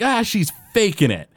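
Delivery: high-pitched mock accusation; negative accusation. Bart accuses his sick sister, negative and dismissive. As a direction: complain like a resentful brother, lightly acted. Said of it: "Bart does it in a very negative way".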